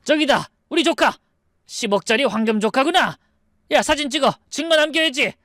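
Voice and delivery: high, greedy calling out; excited shouting. Acted. Eldest brother spotting the nephew through glass, shouting excitedly. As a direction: call out with pitch way up. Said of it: "유리창을 두드리며 소리쳤죠".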